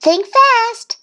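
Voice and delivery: high, loud little-duck challenge; urgent challenge. A narrator's reading. Thistle challenging Goose, high and loud.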